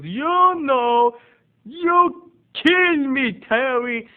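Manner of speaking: very high, loud, drawn-out accusation; theatrical high-pitched impression; imitated eccentric aunt. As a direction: wail the accusation in the odd aunt voice. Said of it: "she talks like this".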